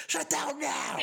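high loud laughing yell; laughing shout. Retold or imitated.

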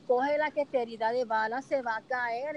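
normal talking voice

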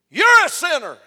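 high, shouted imitation of the accuser; loud accusation. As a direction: yell it at one person before everyone. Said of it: "as loud as he told everybody".

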